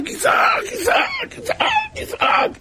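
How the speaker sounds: very high, drawn-out wailing shout; acted crying out. Preacher shows a childless man pleading to God, wailing cry. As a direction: cry out over and over, far above normal pitch. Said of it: "כל אדם יצעק".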